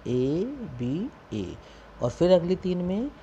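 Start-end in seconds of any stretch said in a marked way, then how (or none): 0.1-1.6 slow spelled pattern. Shown as a demonstration. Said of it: "क्या आया".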